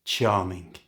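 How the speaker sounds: dry sarcastic reply. Shown as a demonstration. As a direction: say "Charming" with dry irony.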